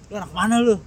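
mock confrontation. Acted confrontation before a street fight.

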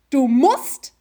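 "musst" stressed with a rising end. Retold or imitated. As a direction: pause after a pointed, rising "musst". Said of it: "behaltet euch das Wort musst im Kopf". (Betonung auf „musst“, ansteigend)

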